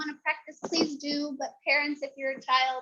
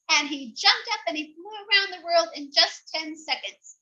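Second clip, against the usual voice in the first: plain reading voice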